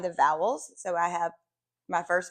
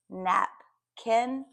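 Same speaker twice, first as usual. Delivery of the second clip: "napkin" slowly split as nap, kin; syllable by syllable; 2 semitones higher than usual. Shown as a demonstration. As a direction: say "napkin" in two separate chunks. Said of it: "put those two syllables together".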